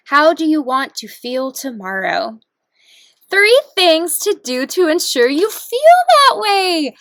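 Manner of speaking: excited reading. Real, not acted. Reading the prompt card excitedly.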